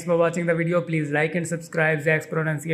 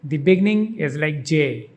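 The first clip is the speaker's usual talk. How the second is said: normal talking voice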